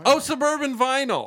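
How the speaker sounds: high, loud oh of realization; suddenly remembering the real sponsor; surprised realization. Real, not acted. Corrected about sponsor.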